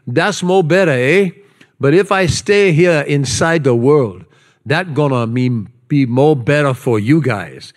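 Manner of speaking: put-on Hawaiian Pidgin lilt; playful Hawaiian Pidgin reading; Hawaiian Pidgin accent. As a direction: read it in your best Hawaiian Pidgin. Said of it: "in my best, uh, Pidgin accent".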